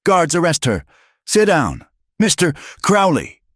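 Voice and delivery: loud commanding bark; barking orders. A narrator's reading. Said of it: "Major Sterling barked".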